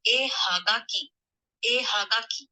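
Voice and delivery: hagaki with a clear h; breathy ha at the start of hagaki; ha read as ha in hagaki; ha sound. Shown as a demonstration. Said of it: "in a word, it would be read as ha".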